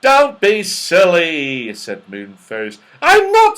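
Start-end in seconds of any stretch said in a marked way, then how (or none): 0.0-1.7 loud, slow, drawn-out scoff; dismissive. A narrator's reading. Moonface doubts Goldilocks saw anyone, dismissive. 3.0-3.6 very high, loud retort; alarmed protest. A narrator's reading. Goldilocks denies being silly, shrieking protest.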